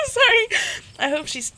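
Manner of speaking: high, loud, laughing; laughing apology. Real, not acted. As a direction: apologise through a real laugh. Apologises while cracking up.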